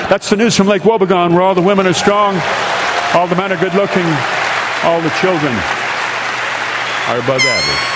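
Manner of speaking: closing the radio monologue for listeners with a fond sign-off. Acted. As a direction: round off the show with the trademark sign-off. Signature show sign-off.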